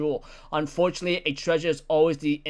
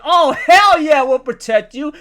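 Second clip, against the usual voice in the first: much higher, loud, hammed-up cheer; eager shout; 9 semitones higher, 12 dB louder than usual. Pirate men shouting to Shakuyaku that they'll protect her. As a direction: bellow your eager yes.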